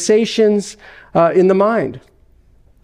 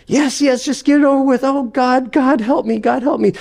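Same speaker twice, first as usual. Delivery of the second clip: higher, frantic pleading; a ghost giving in, terrified; desperate ghost; 8 semitones higher than usual. Acted. Ghost begging God for help, higher and frantic.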